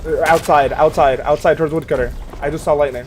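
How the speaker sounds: loud urgent callout to a teammate. Real, not acted. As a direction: shout the warning out fast. Calling out enemy position.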